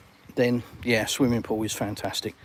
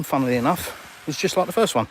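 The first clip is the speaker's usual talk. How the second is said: ordinary delivery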